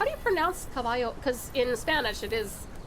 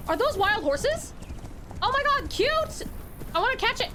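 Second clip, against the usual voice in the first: high-pitched gushing over cute horses; real overexcitement; 9 semitones higher than usual. Real, not acted. Player spotting wild horses, real overexcitement. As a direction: squeal it out, far higher than usual. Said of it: "I got too excited about the horses".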